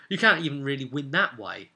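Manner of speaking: ordinary talk, nothing marked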